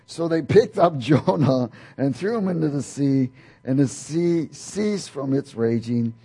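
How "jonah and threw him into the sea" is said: ordinary talk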